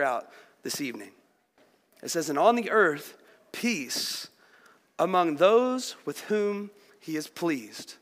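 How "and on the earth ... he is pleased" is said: quiet, slowed reading of the verse; soft scripture reading. Acted. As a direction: let the word peace fall soft and low. Reads verse slowly, softly.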